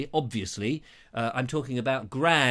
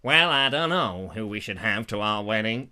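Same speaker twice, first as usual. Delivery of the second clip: deep-voiced Meghan Markle; 4 semitones lower, 3 dB louder than usual. Meghan answering the prince in a deep mock voice. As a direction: lower it into a husky American lady. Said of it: "It's very deep-voiced, Meghan Markle".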